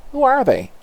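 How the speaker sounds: high, loud shocked question. Acted. Stunned officer asking who the invaders are, loud and high. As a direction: cry it out in disbelief.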